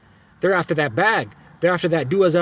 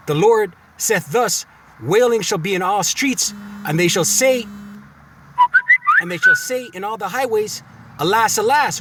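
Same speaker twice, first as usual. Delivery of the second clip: slow, raised declaiming delivery; slow preacher's reading of the prophecy; preaching scripture; 5 semitones higher than usual. Acted. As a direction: proclaim the prophecy to the flock.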